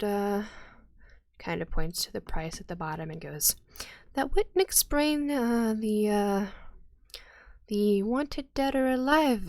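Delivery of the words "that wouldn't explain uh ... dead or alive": npc voice. Acted. NPC points at price.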